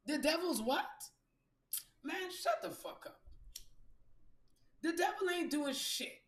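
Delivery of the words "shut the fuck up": ordinary talk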